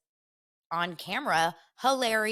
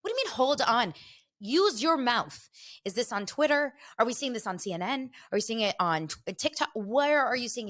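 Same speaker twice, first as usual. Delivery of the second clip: demanding answers, exasperated; 5 semitones higher than usual. Retold or imitated. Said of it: "I'm like".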